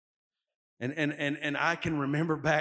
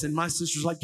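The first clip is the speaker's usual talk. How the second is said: ordinary delivery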